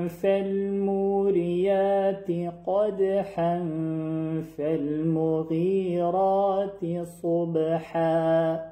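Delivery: continuous tajwid recitation without stops; connected Quranic recitation. Shown as a demonstration. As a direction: recite the verses joined, flowing on. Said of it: "اگر وصل بخوانیم".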